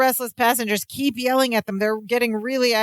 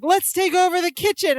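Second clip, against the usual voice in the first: rallying cry; 8 semitones higher than usual. Retold or imitated. Passenger rallying mob.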